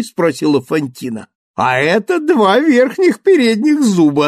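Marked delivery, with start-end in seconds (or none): 1.5-4.3 loud, high huckster's call; charlatan dentist. Acted. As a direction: hawk it like a fairground quack. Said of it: "отвечал дантист". (зазывала-шарлатан)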